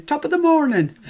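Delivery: a quick cliché Irish lilt. Retold or imitated. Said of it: "all Irish people go around saying".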